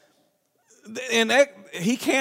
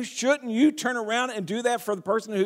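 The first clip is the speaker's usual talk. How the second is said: usual speaking voice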